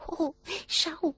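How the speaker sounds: very high goblin voice, drawn-out oh; high kobold voice. A narrator's reading. Goblin showing the boy the treasure hall, very high voice. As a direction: call it out like a tiny goblin. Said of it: "hörte der Junge die Stimme des Kobolds".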